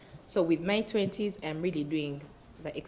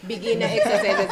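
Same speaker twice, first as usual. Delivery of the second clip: laughing; 4 semitones higher, 9 dB louder than usual. Real, not acted. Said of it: "Bold the word simple".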